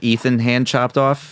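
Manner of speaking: usual speaking voice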